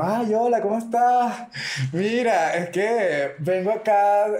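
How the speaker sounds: high-pitched, hammed-up Venezuelan newcomer; super Venezuelan accent. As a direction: introduce yourself in an exaggerated Venezuelan accent. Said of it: "Con mi acento súper venezolano".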